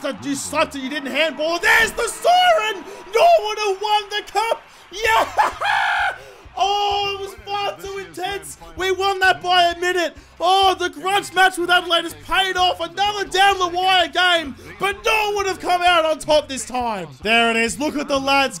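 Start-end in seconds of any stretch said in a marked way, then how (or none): 1.6-5.5 high, loud, slow victory cry; triumphant shout. Real, not acted. Gamer winning the grand final at the siren, triumphant shout. 5.6-6.5 joyful laugh. Real, not acted. Gamer laughing after winning the cup, joyful laugh. Celebrating the win. 6.6-17.1 elated. Real, not acted. Gamer recapping the grand final win, elated.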